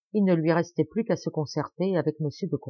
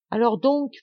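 stammering; 6 semitones higher, 5 dB louder than usual. A narrator's reading. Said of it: "bégaya-t-il".

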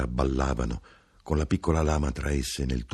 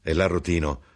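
usual speaking voice

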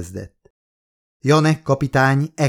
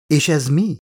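lightly curious question, a bit higher; 5 semitones higher than usual. A narrator's reading. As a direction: ask it with mild wonder, looking around. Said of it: "nézett körül kíváncsian". (kíváncsian, enyhén magasabban)

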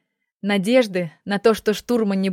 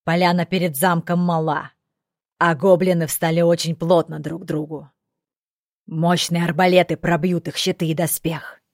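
a hint of a seasoned soldier; elderly corporal; 2 semitones lower than usual. A narrator's reading. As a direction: coolly put the young general in his place. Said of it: "Пожилой капрал недолюбливал Байруса".

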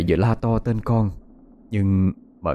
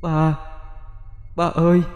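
put-on a child's ghostly call, higher; 8 semitones higher, slower than usual. A narrator's reading. Son's ghost calling to his father, higher voice. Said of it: "có tiếng ai gọi".

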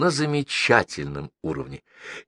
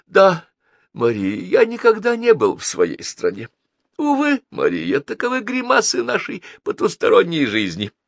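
high-pitched lamenting confession; excitable consul, theatrically rueful; 14 semitones higher, 3 dB louder than usual. Acted. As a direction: sigh grandly over fate in a high voice.

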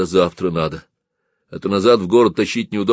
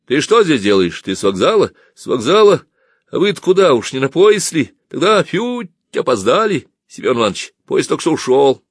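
raised, lively voice; high, loud, animated chatter; eager questioning; 6 semitones higher, 5 dB louder than usual. A narrator's reading. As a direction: break the news of a missed train. Excited greeting, news of missed train.

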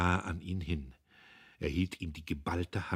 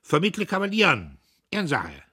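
high, loud, curt officer; loud high lieutenant voice; clipped officer voice; 10 semitones higher, 10 dB louder than usual. A narrator's reading. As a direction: bark it like a brash lieutenant. Premierleutnant voice.

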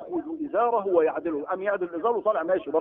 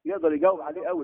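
usual speaking voice

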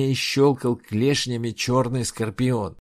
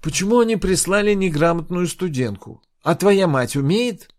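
loud, high exasperated questions; 9 semitones higher, 7 dB louder than usual. Acted. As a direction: scold her, fed up and mocking. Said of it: "Инг закатила глаза". (раздражённо, с презрением)

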